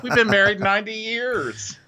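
high-pitched, hammed-up proud couple; exaggerated cutesy couple voice; mock married couple. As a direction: chirp it up high as a silly boast. Mocking boring old couple's boast.

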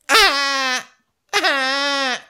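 high wailing baby cry, hammed-up; mock baby wail. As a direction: wail like a newborn baby, mocking. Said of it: "cried like a baby, being like".

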